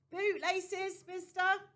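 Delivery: loud, high hawker's call; street hawker's cry. A narrator's reading. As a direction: sell bootlaces to passers-by. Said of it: "he shouted at passers-by".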